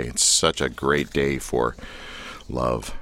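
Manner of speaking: flat, dragging, unenthused; unconvinced deadpan. Acted. Host mocking Valentine's cheer, dragged out unconvinced. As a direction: say it like you don't believe a word. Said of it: "Do I sound convinced?".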